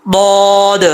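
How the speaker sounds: emphatic full-mouth bood; letter demo. Shown as a demonstration. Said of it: "کیسے؟".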